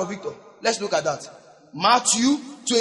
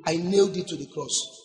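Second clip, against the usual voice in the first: Jesus answering; 5 semitones lower, 6 dB quieter than usual. Acted.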